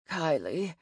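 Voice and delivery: low, weak rusty murmur. A narrator's reading. Wounded demon names the person who found him, rusty voice. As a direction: say it low and rustily. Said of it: "in a rusty voice".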